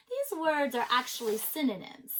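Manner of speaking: ordinary delivery, nothing marked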